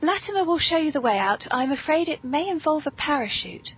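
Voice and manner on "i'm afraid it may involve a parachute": normal talking voice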